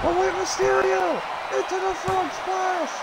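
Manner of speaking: high, thrilled title-win call; wrestling commentator. Acted. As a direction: call the winning pin like an announcer. Winning move call.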